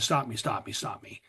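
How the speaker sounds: fading plea to whisper. Retold or imitated. Retold prayer begging God.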